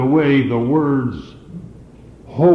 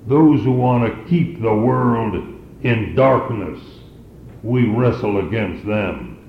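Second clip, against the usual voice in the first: usual speaking voice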